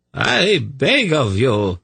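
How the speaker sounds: slow, high-pitched plea; beg, drawing the words out; pleading. A narrator's reading. Zadi begging the priests to leave the bottle, pleading. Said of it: "Zadi pleaded".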